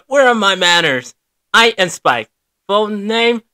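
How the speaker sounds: usual speaking voice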